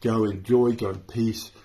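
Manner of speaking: benediction. Real, not acted. Closing benediction.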